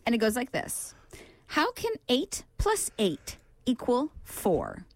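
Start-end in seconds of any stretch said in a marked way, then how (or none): 1.5-4.8 quizmaster's measured delivery with gaps between phrases; slow, deliberate. Real, not acted. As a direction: pose the riddle very slowly, word by word. Posing the brain teaser, first reading.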